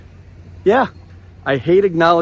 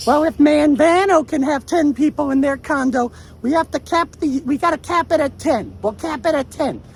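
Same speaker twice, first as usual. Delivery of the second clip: put-on high-pitched Long Island woman's accent, pitched way up; 12 semitones higher than usual. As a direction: mimic a Long Island lady haggling, high. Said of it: "the Long Island accent".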